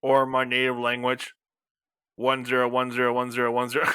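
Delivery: mechanical, one-note binary recital; robot voice. Pretend. Robot speaking its native language, robot voice. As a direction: drone it like a computer. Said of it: "Robot voice".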